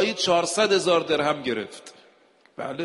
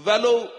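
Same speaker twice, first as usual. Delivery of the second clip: ولو punched out higher and louder; stress on ولو; 6 semitones higher, 5 dB louder than usual. Real, not acted. Preacher warning the congregation, emphatic ولو. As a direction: hit ولو hard, then drop.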